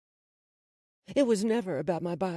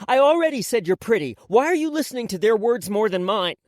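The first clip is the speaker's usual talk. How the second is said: put-on loud, raised-pitch boy's scolding; annoyed yell; 5 semitones higher, 9 dB louder than usual. A narrator's reading. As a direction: yell at her in exasperation. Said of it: "I yelled at her".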